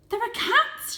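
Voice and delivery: very high, loud urgent cry; shout. A narrator's reading. Varjak shouts the warning to his family, loud and high. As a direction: blurt out the alarm. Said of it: "shouted Varjak".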